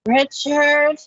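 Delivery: a loud call of the name. Real, not acted. Co-host calling out the caller to stop interrupting, loudly.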